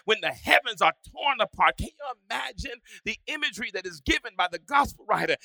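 loud, rising preacher's cry; full-force preaching. Acted. Pastor preaching loudly to his congregation.

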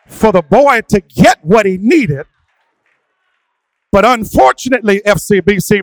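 loud, high, sung-out sermon cadence; preaching at full force. Acted. A pastor calling his church to face demons, fervent and loud. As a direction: drive it home with whooping fervour.

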